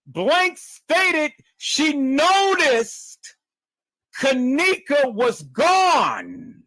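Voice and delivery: "noticed" and "gone" drawn out high; first emphatic reading. Shown as a demonstration. Reader revealing the lie to the audience, slow and emphatic. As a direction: read it out slowly, stretching "noticed" and "gone". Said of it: "put some emphasis upon that".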